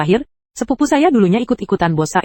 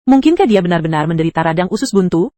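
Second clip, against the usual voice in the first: ordinary delivery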